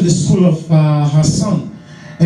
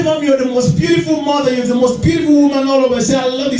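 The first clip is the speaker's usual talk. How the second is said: put-on overjoyed child; 7 semitones higher than usual. Son hugging his scarred mother, high joyful praise. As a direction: burst out with love for his mother.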